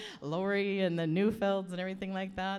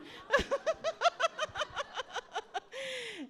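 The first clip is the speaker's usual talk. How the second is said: real cackle. Real, not acted. Auntie promises embarrassing stories, long high cackle. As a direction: burst into a high rattling laugh. Aunt laughs after teasing.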